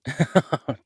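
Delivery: a sudden high, loud laugh; burst of laughter. Acted. Laughing at the camper he just teased. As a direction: laugh out loud at your own joke. Laughs after teasing the listener.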